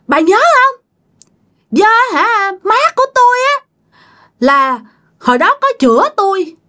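high, piercing accusation; shrill spirit voice. Acted. Spirit accuses grandmother of having it killed, shrill. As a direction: demand that she remember, pitch held way up. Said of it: "bà nói với cái giọng the thé nha".

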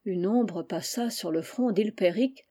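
ordinary delivery, nothing marked

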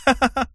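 put-on high girlish ha-ha-ha; teasing laugh. A narrator's reading. Vilma taunting her friend, high mocking laugh.

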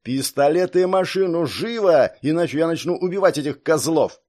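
heated hostage-taker's shouting; angry threat. Acted. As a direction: bark out demands as an ultimatum.

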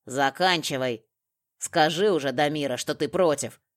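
loud, forceful command; impatient order. A narrator's reading. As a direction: press them loudly to finish. Makarov presses the captive with threats.

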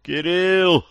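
high, weak call from far off; trapped calling for help. A narrator's reading. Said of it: "вдруг слабо позвал кто-то".

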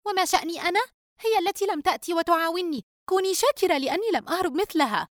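put-on high-pitched, testy young daughter; irritable. A narrator's reading. Daughter protesting to mother that it is not her fault, irritable. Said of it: "رجاء بعصبية".

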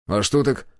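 mild puzzled question; frank surprise. A narrator's reading. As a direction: ask with a touch of surprise. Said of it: "откровенно удивился я". (с лёгким удивлением)

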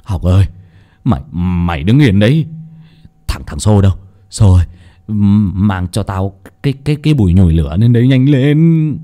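barking orders. Acted. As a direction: shout urgently at the servants. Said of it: "Ông Toán quát".

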